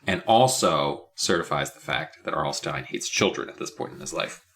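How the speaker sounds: ordinary delivery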